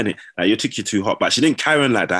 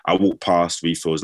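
normal talking voice

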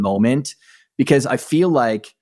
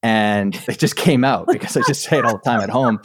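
usual speaking voice

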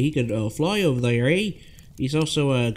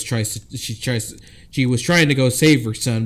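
ordinary delivery

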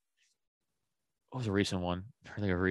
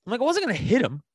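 worked-up rant; 8 semitones higher than usual. Retold or imitated. Retelling his road-rage rant.

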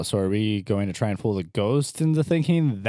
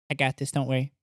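usual speaking voice

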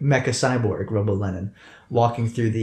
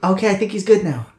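high-pitched character voice; 8 semitones higher than usual. Acted. Commissar voice.

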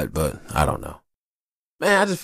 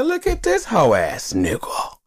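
teasing buddy; 14 semitones higher, slower than usual. Retold or imitated. Ribbing a friend.

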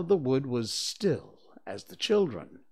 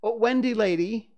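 high and loud eager plea; excited little boy, pitched up and loud; 10 semitones higher, 6 dB louder than usual. Acted. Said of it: "he said rapidly".

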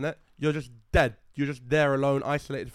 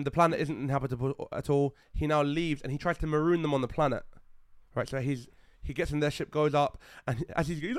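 normal talking voice

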